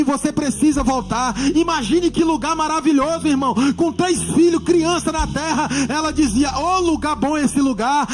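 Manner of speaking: loud, rising pulpit fervour; impassioned preaching. Real, not acted. Preacher telling his mother's heavenly vision, loud impassioned preaching.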